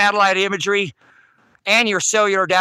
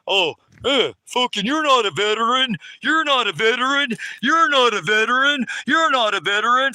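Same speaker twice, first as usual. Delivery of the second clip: higher mocking voice parroting the cops; 5 semitones higher than usual. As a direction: sneer it back like a sneering deputy.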